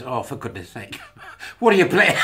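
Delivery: laughing mock aunt, tutting; half-laughing scold like a fond aunt; lightly voiced aunt chiding a niece; disappointed aunt. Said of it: "an aunt that's disappointed".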